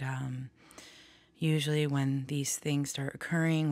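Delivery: normal talking voice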